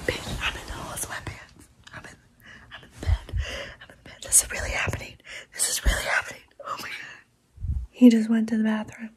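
soft breathy whisper, barely voiced. Real, not acted. Secretly updating viewers from his bed, whispering. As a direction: whisper so he can't hear from the bathroom.

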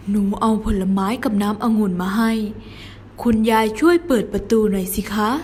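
lightly voiced wolf posing as a little girl; sly wolf, sweetly asking grandma to open up; wolf faking a girl's voice. A narrator's reading. Wolf coaxing grandma to open the door, lightly faked sweetness. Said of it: "หมาป่าดัดเสียง".

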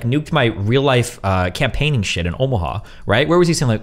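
ordinary delivery

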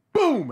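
shouted crash noise; loud boom sound. Retold or imitated.

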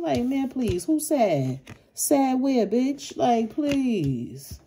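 high, loud, scoffing disbelief; incredulous sass. Real, not acted. Reader scoffing at the ex who expected sadness, high and sassy. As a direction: laugh off the very idea of being sad.

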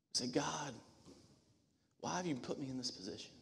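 soft, breathy whispered plea to God; hushed prayer. Acted. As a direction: drop to a near whisper, pleading. Hushed prayer voice after loud build.

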